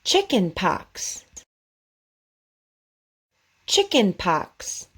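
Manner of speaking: "Chicken pox" said slowly with a crisp KS at the end; over enunciating. Shown as a demonstration. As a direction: spell out "Chicken pox" slowly, hitting the final KS. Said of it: "Just repeat after me".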